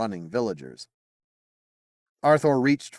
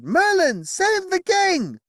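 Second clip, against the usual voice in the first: much higher and louder, drawn-out yell; 6 dB louder, slower than usual. Acted. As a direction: scream it while running. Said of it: "yelling at the wizard".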